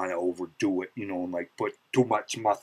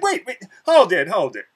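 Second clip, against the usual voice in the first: high, loud, hurried halt; urgent stop; 10 semitones higher, 10 dB louder than usual. Retold or imitated. Stopping the rival from posing, high urgent yelp. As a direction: cut him off in a panic.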